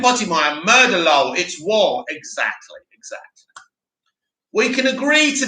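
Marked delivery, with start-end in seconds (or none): 2.1-3.6 breathy whispered agreement. Real, not acted. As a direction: whisper it back, conspiratorial.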